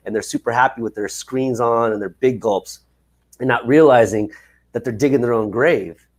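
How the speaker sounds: ordinary talk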